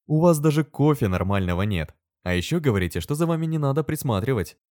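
lecturing reproof; didactic. A narrator's reading. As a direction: scold them instructively. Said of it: "проговорила наставительно".

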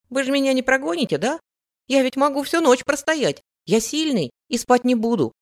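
put-on high, loud, eager kid; pleading boy. A narrator's reading. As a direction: beg like a little boy who won't leave.

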